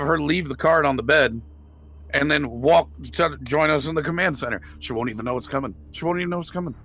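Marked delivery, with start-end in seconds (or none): none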